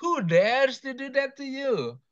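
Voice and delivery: high, slow, hammed-up dismay; mock outrage. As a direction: play it up as shocked. Student kicked out of Zoom.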